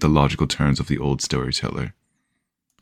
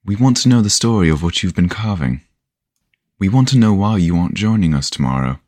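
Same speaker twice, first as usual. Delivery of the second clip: higher-pitched, pressing demand; youthful impatience breaking out; impatient; 6 semitones higher than usual. A narrator's reading. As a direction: push for an answer, patience worn thin. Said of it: "let his impatience get to the better of him".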